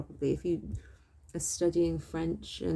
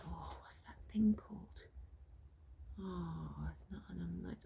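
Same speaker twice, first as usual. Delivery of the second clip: whispered aside, half to yourself; 15 dB quieter than usual. Real, not acted. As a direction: whisper to yourself while trying to remember. Searching memory, muttering aside.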